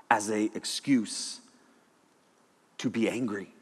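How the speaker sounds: low, soft, sober delivery; quiet solemn confession. Real, not acted. Speaker admitting anger cost him relationships, quiet and solemn. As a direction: confess it softly, with long pauses.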